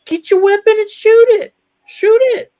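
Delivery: high, loud, urgent command; hammed-up alarm at full pitch; mock panicked shout. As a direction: reenact yelling orders in an emergency. Retelling own panicked reply to Cuppy.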